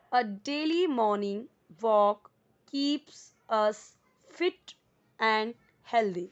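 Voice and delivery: very slow, each word spaced; slow model sentence. Shown as a demonstration. Teacher reading example sentence, very slow. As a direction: read it for the class to copy. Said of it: "model sentence read slowly".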